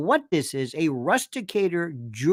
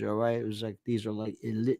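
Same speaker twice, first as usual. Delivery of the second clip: ordinary delivery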